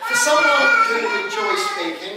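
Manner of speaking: usual speaking voice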